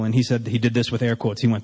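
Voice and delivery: ordinary talk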